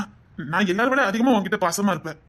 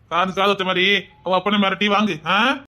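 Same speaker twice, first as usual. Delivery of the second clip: ordinary talk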